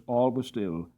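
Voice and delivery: ordinary delivery, nothing marked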